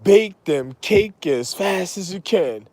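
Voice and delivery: normal talking voice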